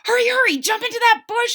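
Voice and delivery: high, loud urgent call; urgent shout. A narrator's reading. Charles urges Wilbur to hide from the dog, shouting. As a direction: rush him to hide. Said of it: "shouted Hector".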